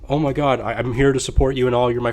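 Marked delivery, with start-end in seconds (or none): none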